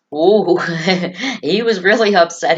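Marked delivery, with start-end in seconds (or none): none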